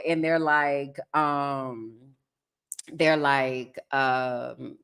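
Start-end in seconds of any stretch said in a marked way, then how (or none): none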